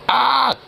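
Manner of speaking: high imitated cry of pain; mock yelp. Player imitates a hurt teammate's high yelp. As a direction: yelp like you just got hurt. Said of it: "you basically just be like".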